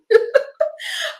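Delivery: breathy airy giggle; quick unvoiced chuckle. Real, not acted. Laughs at her own description.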